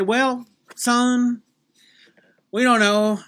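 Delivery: put-on southern accent. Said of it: "I should drop the southern accent".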